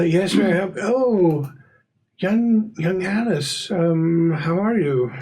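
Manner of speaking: high-pitched, hesitant neighbor greeting a visitor; Mister Corbett. Acted. Neighbor answering the door to young Alice, high-pitched and hesitant. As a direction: pitch it up, a politely surprised householder.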